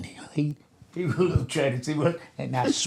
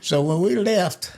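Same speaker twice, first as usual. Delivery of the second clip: ordinary talk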